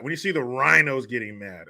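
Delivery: normal talking voice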